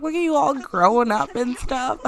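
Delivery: fond big sister. Acted. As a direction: coo over how grown up he is. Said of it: "She's like".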